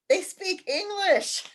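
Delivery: a cheeky try at an Irish lilt, giggling through it; attempted Irish accent. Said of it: "I tried to do my best Irish accent".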